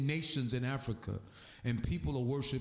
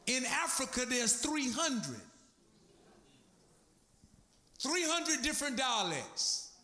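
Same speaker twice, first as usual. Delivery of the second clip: fired-up preaching; 8 semitones higher than usual. Acted. Preacher urging his congregation toward one faith, fired-up and high. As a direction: preach it home, building to a peak. Preaching builds to full force.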